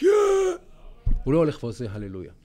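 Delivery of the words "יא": short mock shout "יא"; retold yell "יא". Imitating a player yelling at the camera, a short mock "יא". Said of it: "צועק".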